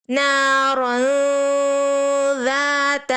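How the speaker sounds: نارن ذات with the ن hidden in the nose; recitation with ghunna. Shown as a demonstration. Teacher recites نارن ذات as the model. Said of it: "تو اس طرح".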